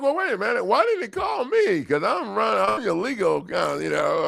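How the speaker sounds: high, shouted mock protest; retold mock outrage; mock indignation. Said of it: "I'm like".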